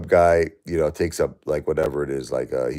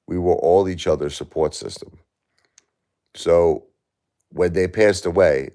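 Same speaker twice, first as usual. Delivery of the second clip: ordinary delivery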